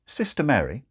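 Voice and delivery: ordinary delivery, nothing marked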